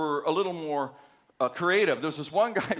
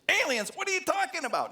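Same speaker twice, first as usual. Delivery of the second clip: much higher, incredulous yelp of confusion; baffled disbelief. Retold or imitated. Car salesman confused by a call about alien abduction, incredulous. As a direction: sound utterly thrown by the question.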